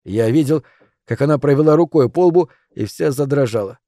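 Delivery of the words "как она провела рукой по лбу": ordinary delivery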